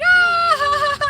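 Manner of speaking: high, loud open-mouthed "ah"; imitated laughing cry. Sister laughing as the fish flies in, high cry.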